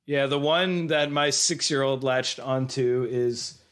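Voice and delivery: normal talking voice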